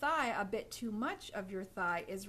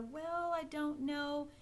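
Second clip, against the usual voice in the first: hesitant; 5 semitones higher, slower than usual. Shown as a demonstration. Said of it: "These kinds of mannerisms".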